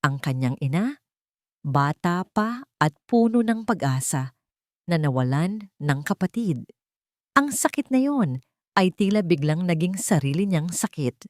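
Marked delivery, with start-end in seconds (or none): none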